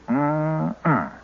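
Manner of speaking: normal talking voice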